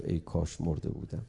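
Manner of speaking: the words «ای کاش مرده بودم» dropped to a near-silent, low murmur; hushed, low «ای کاش مرده بودم», denied in a murmur; barely voiced. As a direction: let «ای کاش مرده بودم» fade almost to nothing, spoken low and soft. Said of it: "اما نگفت".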